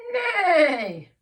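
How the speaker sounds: long, loud, high neigh; goose neighing mightily. A narrator's reading. Teacher voices the goose pretending to be a horse, mighty neigh. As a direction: neigh with all your might. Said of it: "with all her might".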